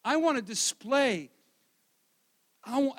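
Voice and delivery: ordinary talk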